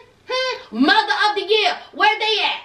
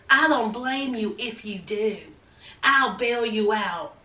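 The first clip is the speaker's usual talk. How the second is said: much lower, softer mocking imitation of Sean; 10 semitones lower, 5 dB quieter than usual. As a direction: drop low and quiet to mock him. Mimicking Sean's reply.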